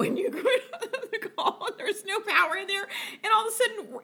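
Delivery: high, giggly storytelling; laughing while talking. Real, not acted. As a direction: try to tell it through real laughter. Laughing while telling own story.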